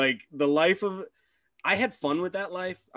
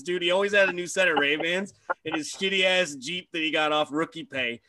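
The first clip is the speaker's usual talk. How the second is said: ordinary talk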